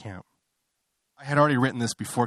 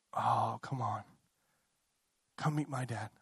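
subdued invitation; 10 dB quieter than usual. Acted. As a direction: invite quietly, holding the excitement back. Acts out awkward, timid invitation.

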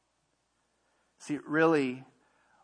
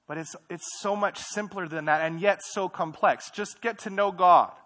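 builds louder and higher to a ringing close; climbing to a loud, high finish; rising emphatic preaching; 6 semitones higher, 9 dB louder than usual. Real, not acted. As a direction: preach it up to the peak.

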